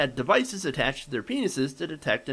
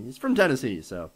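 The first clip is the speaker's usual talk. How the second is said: ordinary delivery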